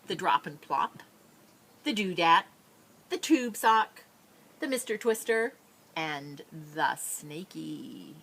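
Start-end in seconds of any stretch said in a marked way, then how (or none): none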